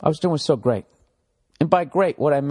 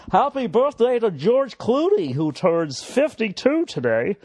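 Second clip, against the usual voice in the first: mock birthday announcement; 11 semitones higher than usual.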